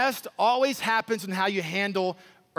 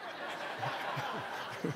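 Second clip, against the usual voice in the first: breathy laugh; 13 dB quieter than usual. Real, not acted. Laughs after mock taunt.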